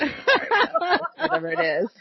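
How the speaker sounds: high-pitched, laughing brush-off; mock "whatever" shrug, breaking into laughter; mock dismissive. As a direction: toss it away in a high voice. Quotes own dismissive thought, laughing.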